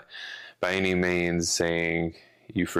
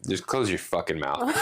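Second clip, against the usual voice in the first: laughing; 5 semitones higher than usual. Real, not acted. Laughing through exasperated rant.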